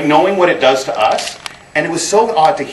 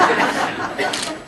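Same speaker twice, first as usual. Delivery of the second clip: breathy laugh; 5 dB quieter than usual. Real, not acted. Laughs at own high school memory.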